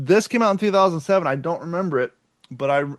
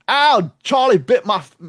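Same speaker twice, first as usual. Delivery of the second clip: put-on British accent; 5 semitones higher, 4 dB louder than usual. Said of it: "I'm still doing the British accent".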